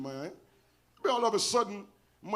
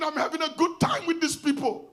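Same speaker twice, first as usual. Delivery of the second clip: normal talking voice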